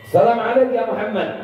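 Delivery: high hopeful greeting; high, hopeful salam. Acted. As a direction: greet the man you crossed the desert for. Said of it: "ini orang Yahudi ngomong".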